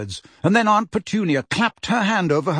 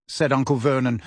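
plain reading voice

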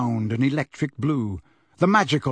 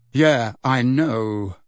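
very slow, awkward reply; uneasy boy, unsure what to say; uncomfortable; 2 semitones lower, much slower than usual. Acted. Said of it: "said Harry uncomfortably".

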